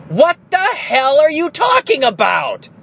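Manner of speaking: high, loud exasperated snap; a mock snap at his wife, high and loud; snapping. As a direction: imitate losing patience and snapping. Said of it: "too easy to snap and go".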